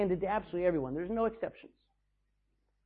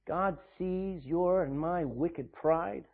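ordinary delivery

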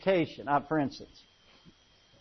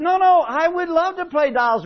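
eager reply; 10 semitones higher, 5 dB louder than usual. Retold or imitated.

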